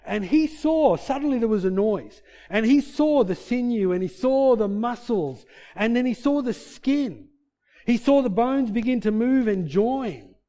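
high, loud storytelling sermon; impassioned preaching. Acted. Preacher retelling Ezekiel's vision to the congregation, impassioned. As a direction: preach it slowly building wonder, hitting each image.

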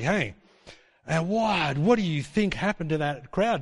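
usual speaking voice

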